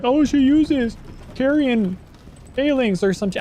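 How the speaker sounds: mocking other player. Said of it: "someone's like".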